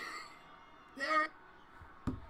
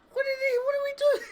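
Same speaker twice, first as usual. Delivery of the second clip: incredulous; faster than usual. Real, not acted. Baffled by the segment.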